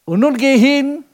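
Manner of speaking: hoch und laut als Gott gerufen; Stimme Gottes. Acted. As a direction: rufe es als Gott von oben herab. Gott spricht zu Mose. (laut gerufene Gottesstimme)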